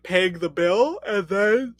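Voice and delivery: normal talking voice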